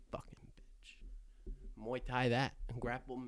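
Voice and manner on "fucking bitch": slow breathy whisper, barely audible; whispered insult. Pretend. Host jokingly cursing Rogan, whispered very softly. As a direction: hiss it under your breath, mock-bitter.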